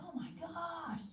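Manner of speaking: dismay at what she feels; mock alarm; alarmed surprise. Alarmed reaction.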